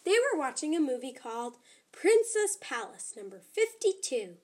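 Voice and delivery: usual speaking voice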